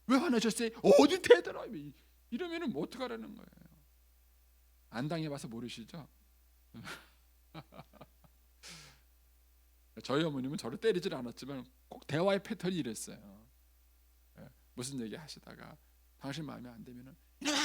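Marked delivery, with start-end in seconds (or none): 0.1-1.7 much higher, much louder quarrel flare-up; acted angry outburst. Spouse demanding an explanation gets an angry outburst. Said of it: "갑자기 화를 확 내요". 17.4-17.6 explosive mock scolding shout; imitated angry yell. Mother suddenly losing her temper at her child, yelling. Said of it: "하고 막 화를 내요".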